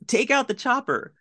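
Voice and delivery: mock yell. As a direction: bark the order in jest. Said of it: "Burke yells".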